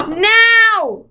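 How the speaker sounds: high, loud barked "Now!"; sharp command. A narrator's reading. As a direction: snap out the order like a witch. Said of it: "Missus Witch commanded".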